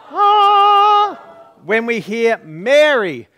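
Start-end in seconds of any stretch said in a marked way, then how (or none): 0.1-1.2 high loud sung ah; a bright choir-like ah, high and loud; angel ah. Shown as a demonstration. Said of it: "we say".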